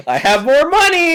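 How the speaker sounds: high, loud pleading shout; desperate shout. Pretend. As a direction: shout after them desperately. Voicing desperate character.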